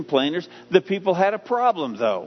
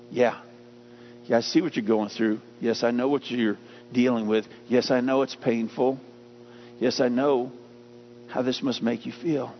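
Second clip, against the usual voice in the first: lower, soft and reassuring, like a comforting father; gentle God's answer; 5 semitones lower, 4 dB quieter than usual. Acted. God answering a hurting believer, softly and reassuringly. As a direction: speak softly, as if soothing a hurting child.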